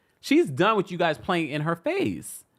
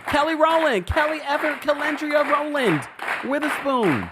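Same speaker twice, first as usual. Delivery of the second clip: excited yelling; 11 semitones higher, 4 dB louder than usual. Real, not acted. Host naming her dream talk show guest, yelling excitedly. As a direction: yell the full name with over-the-top hype. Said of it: "'cause I'm yelling, I'm screaming".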